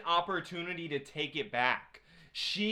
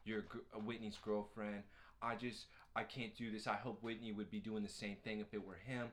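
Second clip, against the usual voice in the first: low, very soft mumbled impression; subdued, mock-hesitant quote; ambiguous, hesitant boyfriend; 10 semitones lower, 13 dB quieter than usual. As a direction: mimic him backing out, soft and low. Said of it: "so ambiguous on everything where he's just like".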